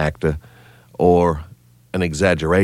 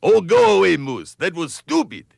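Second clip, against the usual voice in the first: exasperated Rocky voice; 13 semitones higher than usual. Acted. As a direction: snap at the moose, annoyed. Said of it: "a couple of friends here".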